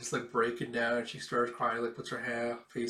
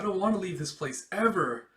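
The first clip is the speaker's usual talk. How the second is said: put-on childish plea; 7 semitones higher, 5 dB louder than usual. Boy character begging to stay.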